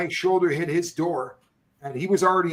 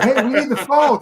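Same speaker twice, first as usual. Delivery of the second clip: loud high call for the phone; retold shout; 8 semitones higher, 6 dB louder than usual. Calling out to the cop for the phone, loud. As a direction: holler it out to the passing cop.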